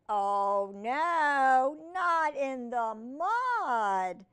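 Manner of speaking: very slow, loud "oh no"; drawn-out dismay. Acted. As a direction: stretch each word in mock horror. Story dismay at mud.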